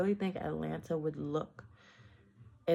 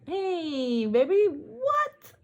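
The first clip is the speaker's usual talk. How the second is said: playful shout; 11 semitones higher, 8 dB louder, much slower than usual. Real, not acted. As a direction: yell it out, stretched and squealing.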